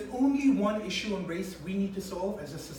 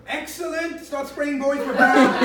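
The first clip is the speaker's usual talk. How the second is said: high, loud and delighted, cheering the crew on; a baby thrilled with its colour, louder and higher; excited cheer; 4 semitones higher, 10 dB louder, slower than usual. Pretend.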